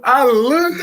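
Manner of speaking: high-pitched, cracking up mid-story; real laughing speech. Real, not acted. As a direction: talk through your own laughter. Laughing at own funny story.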